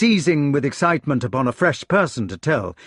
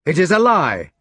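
louder knights' shout; shouted denial; 5 semitones higher, 5 dB louder than usual. A narrator's reading. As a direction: protest loudly. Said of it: "the knights shouted".

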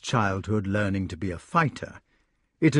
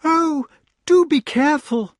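very high, loud fretful cry; lady crying out in worry; anxious cry; 6 dB louder than usual. Acted. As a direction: cry up in alarm. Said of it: "cried the lady".

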